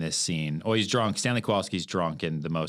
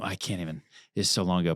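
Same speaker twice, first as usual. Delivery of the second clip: ordinary delivery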